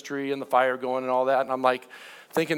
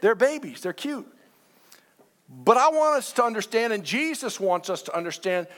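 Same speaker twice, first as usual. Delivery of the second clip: ordinary talk, nothing marked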